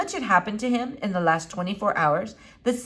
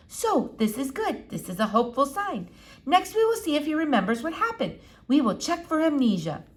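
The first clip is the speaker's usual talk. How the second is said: lightly voiced elderly foreign lady, higher; a hint of an old eccentric doctor; Doctor Measham; 7 semitones higher than usual. A narrator's reading. Old doctor peering at the squirrel, lightly foreign.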